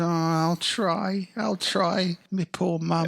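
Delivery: high, slow, struggling orphan voice; pitiful orphan. Acted. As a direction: whimper along as a brave little urchin. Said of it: "this little one-legged orphan".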